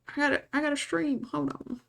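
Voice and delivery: put-on little old southern man accent. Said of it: "a little southern old man accent".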